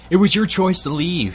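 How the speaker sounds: countering. A narrator's reading. Lover answering a reproach about their parting, countering. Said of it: "he countered".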